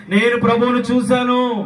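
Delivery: high, ringing shout of testimony; loud proclamation. Acted. Preacher showing believers how to testify, loud proclamation.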